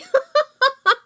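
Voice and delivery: laughing fit; high laugh. Real, not acted.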